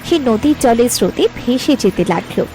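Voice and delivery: ordinary talk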